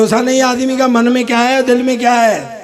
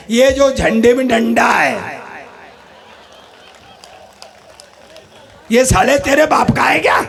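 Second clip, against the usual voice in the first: punchline shout. Acted. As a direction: hurl the punchline at the Englishman.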